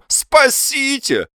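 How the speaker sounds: very high, drawn-out wail; stretched high-pitched cry; crying for help. A narrator's reading. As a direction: yelp it out melodramatically. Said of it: "и с криком".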